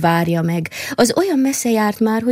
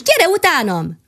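higher, lively squirrel call; 8 semitones higher than usual. Acted. Squirrel urges the mole to follow her, higher and lively. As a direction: beckon him playfully.

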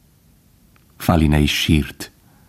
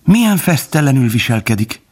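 high-pitched cry of astonishment; exclaiming; 8 semitones higher than usual. A narrator's reading. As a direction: exclaim it high, without really shouting. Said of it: "kiáltotta Faline".

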